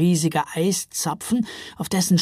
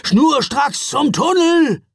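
much higher, louder, slow shouted order; urgent shout; 10 semitones higher, 7 dB louder, slower than usual. A narrator's reading. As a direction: shout them straight to the tunnel. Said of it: "rief er".

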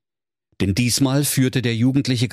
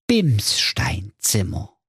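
slow reveal; much slower than usual. Acted. The grand reveal.

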